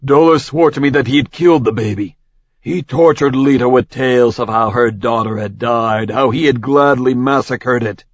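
fast, tense king of gods; rattled god insisting; Zeus's voice. Acted. Zeus shocked.

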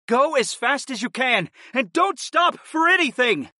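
high, pressing shout; urgent calling out. A narrator's reading. Fighter to departing mother-in-law, calling out urgently. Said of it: "I called out to my mother-in-law".

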